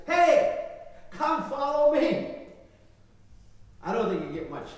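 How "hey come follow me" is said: calling out. Pretend. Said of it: "are all lined up and says".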